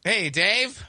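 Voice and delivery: bright staged hello to a friend; host pretending the call has just connected, cheery; mock fresh greeting. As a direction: greet him as if just picking up.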